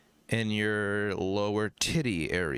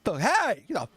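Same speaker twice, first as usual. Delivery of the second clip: high, loud hammed-up holler; yelling at intruder; 13 semitones higher, 5 dB louder than usual. Homeowner to a hobo in the yard, mock angry yell. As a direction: chase someone off with a shout. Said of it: "Pretend like your, y-, your, your yard has".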